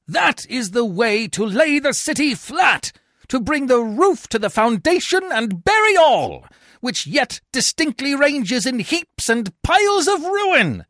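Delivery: high, slow, forceful shouting; angry shout. A narrator's reading. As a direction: shout a warning of ruin. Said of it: "Coriolanus shouts".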